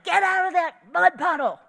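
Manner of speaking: high, loud imitation of a yelling mother; mom's yell. Mom to kids in the mud puddle, imitated yell. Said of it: "Mom yells out and said".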